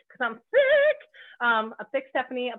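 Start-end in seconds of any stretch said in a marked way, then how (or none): 0.5-1.0 loud, high-pitched flourish; playful high squeal. Real, not acted. Host showing off her nickname, playful high squeal. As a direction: jump way up high on the last word.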